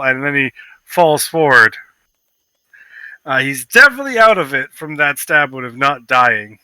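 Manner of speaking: ordinary delivery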